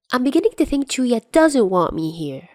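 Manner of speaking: playfully wounded, fishing for sympathy; fake-pouting tease; mock hurt. Dazai teasing, fake hurt.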